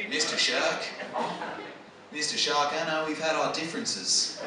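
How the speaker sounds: low, soft, conciliatory appeal; reasoning Australian diver. Retold or imitated. Diver asks the shark to settle it like men, reasoning. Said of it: "and I'm thinking".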